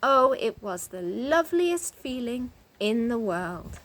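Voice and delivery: ordinary delivery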